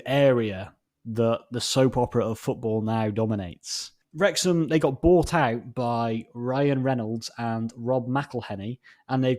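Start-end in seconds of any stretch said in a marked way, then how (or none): none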